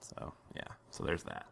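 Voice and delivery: smug breathy whisper. Real, not acted. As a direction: whisper it with a grin. GM whispers smugly.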